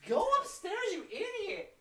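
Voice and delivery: high mock scolding. Real, not acted. Scolding the pet, laughing.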